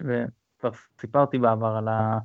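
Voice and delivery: ordinary talk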